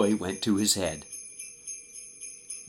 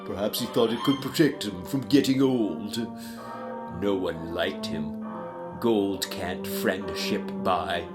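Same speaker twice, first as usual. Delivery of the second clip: declamatory gentleman, slowing to a weighty end; judging gentleman; 2 semitones higher, 5 dB louder than usual. Acted. Businessman speaks of the dead miser, weighty and disapproving. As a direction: pronounce judgment in measured rhyme.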